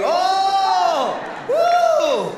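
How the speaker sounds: high, loud surprised whoop; excited whoop. Real, not acted. Comedian spotting a friend in the crowd, high whoop. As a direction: yelp with delight.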